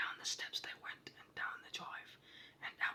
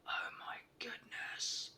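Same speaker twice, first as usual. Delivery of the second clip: ordinary delivery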